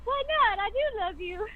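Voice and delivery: lightly voiced sweet girl, no shame at all; carefree Helen. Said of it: "but Helen's like".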